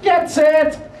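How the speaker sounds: high, ringing starter's call; mock starter at the line; race starter call.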